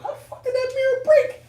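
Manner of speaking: mock stunned disbelief. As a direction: squeal it in hammed-up disbelief. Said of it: "I was like".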